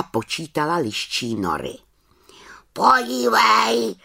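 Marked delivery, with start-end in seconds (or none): 2.7-3.9 high, slow croaking raven voice. Acted. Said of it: "zakrákal po chvíli Havran". (krákavý havraní hlas)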